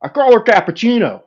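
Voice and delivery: high, loud catchphrase impression. Retelling strangers' catchphrases at the airport, high loud impression. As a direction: call it out high in a goofy celebrity voice. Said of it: "I said a stupid [name] thing".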